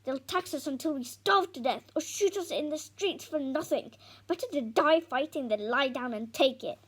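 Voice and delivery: loud, bitter ranting father; Dad rails against the taxes, loud and furious; angry rant. Acted.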